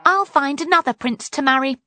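fast, high, defiant announcement; declaring. A narrator's reading. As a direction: declare it boldly and quickly. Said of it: "Poppy declared".

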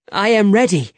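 eager readiness. A narrator's reading. Young man keen to fight.

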